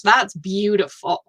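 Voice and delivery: ordinary talk, nothing marked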